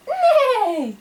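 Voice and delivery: high whinny falling at the end; horse neigh. Shown as a demonstration. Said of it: "Can you do this?".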